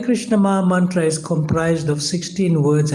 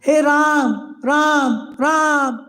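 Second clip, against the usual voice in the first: affectionate calling out; 9 semitones higher than usual. Acted. Teacher voicing Maharaj Dasaratha separated from his Lord, affectionate high call. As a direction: call out to him, high and sustained. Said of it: "that's an affectionate calling out".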